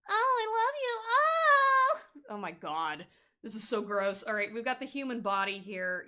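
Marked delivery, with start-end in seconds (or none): 0.1-2.3 much higher, gushing lovesick voice; mock love bomber. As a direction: overdo the lovesick ex, pitched way up. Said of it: "coming back being like".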